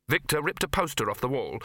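plain reading voice

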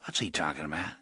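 low, quiet gang boss; low-pitched question from the boss. A narrator's reading. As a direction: ask it low and softly, lightly menacing. Menacing gang boss questions underling.

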